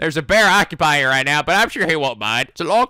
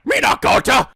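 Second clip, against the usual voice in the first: indignant Gon; 6 semitones higher than usual. Retold or imitated. Gon correcting the wrong name, with an indignant shout. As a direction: shout it like an offended little boy.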